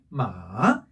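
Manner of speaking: "마" slow, dipping low then rising; third tone. Shown as a demonstration. Teacher reviewing tones, "마" low dipping third tone. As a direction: sink "마" to the bottom, then lift it. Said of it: "삼 성은".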